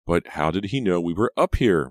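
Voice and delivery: mildly acted urgency; demanding. A narrator's reading. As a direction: demand an explanation. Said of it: "Reva demanded".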